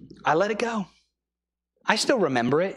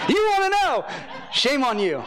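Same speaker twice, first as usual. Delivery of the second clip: teasing; 11 semitones higher than usual. Real, not acted. Teasing the congregation's curiosity.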